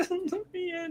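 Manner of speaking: high, thin mock plea at the window; begging to be let in. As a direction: whimper it like someone shut outside. Curtis locked outside begging.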